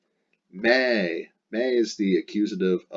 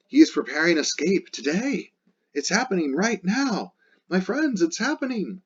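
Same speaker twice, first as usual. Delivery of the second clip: much higher, urgent mock shout; shouting to friends; 10 semitones higher than usual. Dido shouting to her friends that he is leaving. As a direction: cry it out in alarm to her friends. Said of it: "she is shouting this".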